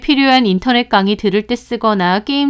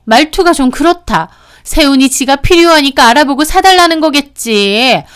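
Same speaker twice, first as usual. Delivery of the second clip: bristling sister-in-law, higher and louder; 발끈한 시누이; 5 semitones higher, 6 dB louder than usual. A narrator's reading. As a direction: snap back indignantly as the offended sister-in-law. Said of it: "제 말에 신우 몹시 발끈하더라고요". (발끈해서 높고 크게 쏘아붙이는 말투)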